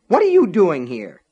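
loud, high, stunned demand; shocked demand. A narrator's reading. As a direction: burst out at her in disbelief. Catches friend in king's bed.

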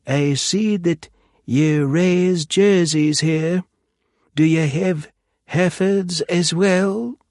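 put-on high, slow prissy English accent; pompous professor with a monocle. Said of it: "professorial tone of voice, throwing in a prissy English accent".

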